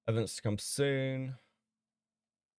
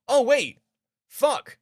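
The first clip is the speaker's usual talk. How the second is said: sudden high yelp of shock; shocked outburst; 13 semitones higher, 9 dB louder, slower than usual. Real, not acted. Streamer realizing the sting link, shocked high shout. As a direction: blurt it out as it dawns on you.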